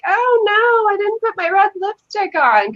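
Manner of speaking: high-pitched fretting; mock dismay. Joking about appearing on camera without lipstick, high mock dismay.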